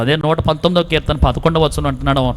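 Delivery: ordinary talk, nothing marked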